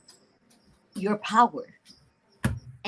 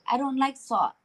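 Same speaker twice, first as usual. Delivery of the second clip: normal talking voice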